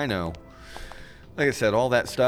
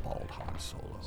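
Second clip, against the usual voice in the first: a voiceless, much softer insult aside; whispered jab; 11 dB quieter than usual. Acted. Hondo mocking Chuck behind his back, whispering. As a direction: sneak the insult in under your breath.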